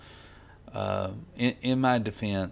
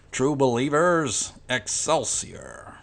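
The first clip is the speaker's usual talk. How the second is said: higher, slow showman's proclamation; Stan Lee impression; 4 semitones higher, 5 dB louder, slower than usual. Vlogger signs off as the comic legend would, slow hammed-up impression. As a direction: hail the fans in his voice, stretching every word. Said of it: "that wasn't too bad of an impression".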